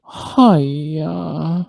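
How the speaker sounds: long, gritty mimicked hi-ya; gritty, dragged out. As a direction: stretch the noise out with grit. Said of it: "like, dragged it".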